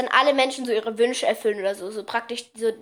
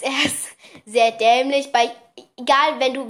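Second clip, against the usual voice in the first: normal talking voice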